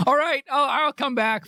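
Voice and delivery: ordinary talk, nothing marked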